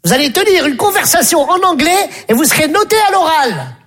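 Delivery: high-pitched teacher summoning pupils; English teacher. Acted. English teacher calls two pupils to the blackboard, high and commanding.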